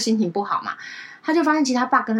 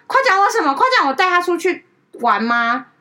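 defensive retort; 7 semitones higher, 6 dB louder than usual. Retold or imitated. Friend's defensive retort, retold.

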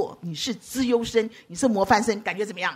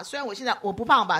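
usual speaking voice

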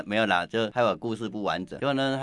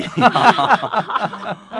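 laughing; 11 semitones higher, 10 dB louder than usual. Real, not acted. Teacher laughs after siren joke.

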